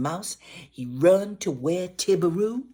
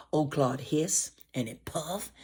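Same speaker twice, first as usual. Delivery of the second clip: ordinary delivery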